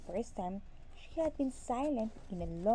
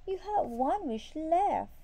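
high, loud insistence from the wife; begging; 7 semitones higher, 6 dB louder than usual. A narrator's reading. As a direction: plead loudly with the husband. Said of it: "begged his wife".

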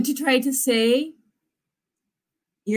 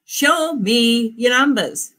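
normal talking voice

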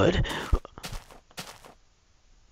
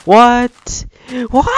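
high, loud cry of disbelief; shocked; 8 dB louder than usual. Real, not acted. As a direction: burst out in amazement. Amazed by crowd of animals.

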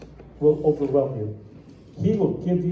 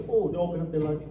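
ordinary talk

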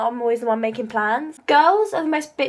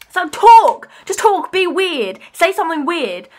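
loud, high plea to loosen up; exasperated urging; 4 semitones higher, 8 dB louder than usual. Retold or imitated. Girl urging her withdrawn best friend to open up, exasperated. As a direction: burst out in frustration at a friend.

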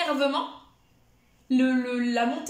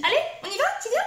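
higher, bright but not shrill call; high-pitched motivation; 10 semitones higher, 3 dB louder, much faster than usual. Shown as a demonstration. Trainer showing the high motivating voice for the dog. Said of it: "avec une voix aiguë, mais pas non plus suraiguë".

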